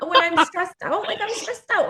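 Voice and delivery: a light mock of her own frazzle; mock stressed-out. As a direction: pitch it up like a frazzled mom. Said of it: "self-mocking repeat".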